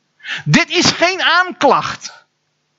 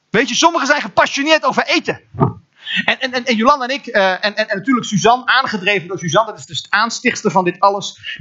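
usual speaking voice

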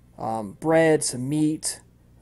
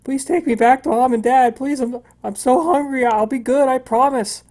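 put-on high, pleading child's sob, louder; crying boy; 10 semitones higher, 6 dB louder than usual. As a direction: beg through tears like a starving little boy. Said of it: "He's crying".

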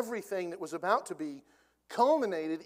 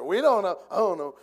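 loud, flustered we-don't-know blurt; hammed-up panicked dodge; mock flustered blurt; 4 semitones higher, 12 dB louder than usual. Pharisees blurting a flustered dodge to Jesus, loud and mock-panicked. Leaders' flustered reply, retold.

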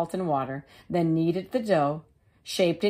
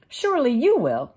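high, bright hen voice; little red hen; 6 semitones higher, 4 dB louder than usual. Acted. The hen asking neighbours about seeds, in a high voice. Hen's voice.

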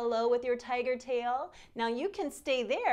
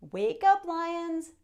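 high, louder wake-up call; cheerful call; 6 semitones higher, 4 dB louder, slower than usual. Acted. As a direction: rouse them brightly. Waking the lions again.

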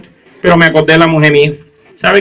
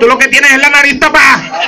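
scolding grandma; 8 semitones higher than usual. Acted. Grandma voiced in comic story.

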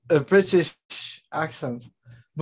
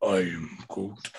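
deep Groot; 9 semitones lower, 7 dB quieter, slower than usual. Retold or imitated. Groot impression.

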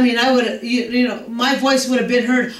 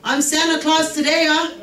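ordinary talk, nothing marked

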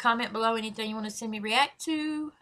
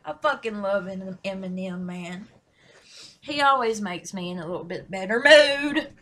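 ordinary talk

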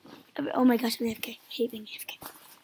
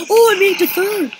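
excited shout; 7 semitones higher, 12 dB louder than usual. Real, not acted. Placing third in race.

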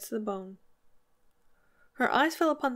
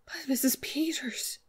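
slow, higher, half-whispered cry; breathy cry; 5 semitones higher, slower than usual. A narrator's reading. Missus Hale to Missus Peters over the dead bird, breathy cry. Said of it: "cried Missus Hale".

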